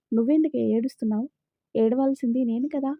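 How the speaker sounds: ordinary delivery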